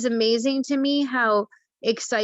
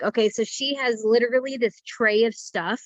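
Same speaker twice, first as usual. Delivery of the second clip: normal talking voice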